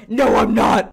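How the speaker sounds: hammed-up screaming denial; mock scream. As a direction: scream it back childishly. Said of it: "cutest thing ever".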